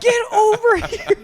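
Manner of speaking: high, loud, drawn-out summons; urgent call. Retold or imitated. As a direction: yell it to pull her over. Calling friend over FaceTime.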